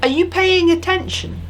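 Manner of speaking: bright high-pitched call for attention, leaning on paying; teacher's high sing-song check-in; high attention-getter. Shown as a demonstration. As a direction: pitch it up high to grab the class. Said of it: "how would I say that".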